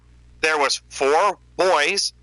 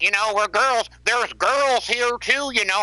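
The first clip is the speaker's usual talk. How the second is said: mocking girl voice; 7 semitones higher than usual. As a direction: mimic her as a whiny scold.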